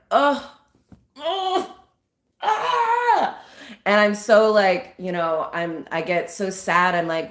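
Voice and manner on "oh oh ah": mock crying wail. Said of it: "I burst into tears".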